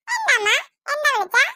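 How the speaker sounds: put-on very high sweet child voice; little girl voice. Little sister answering mother's call. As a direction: answer mum innocently.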